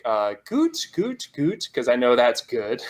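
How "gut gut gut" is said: unsure German reply. Retold or imitated. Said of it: "I'm like".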